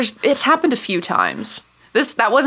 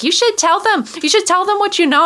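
insistent mom; 10 semitones higher than usual. Retold or imitated. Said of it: "she's like".

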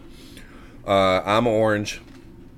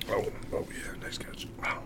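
breathy whisper; hushed, almost silent; 15 dB quieter than usual. Real, not acted. Whispered after a catch.

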